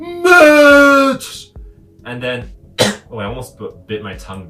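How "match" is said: much higher, much louder yell of a name; huge shout. Retold or imitated. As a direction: bellow the name across the room. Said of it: "really loudly, with giant text".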